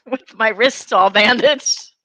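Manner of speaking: slow, laughing through the words; can barely get it out for laughing. Real, not acted. She recalls walking into church group bandaged, laughing.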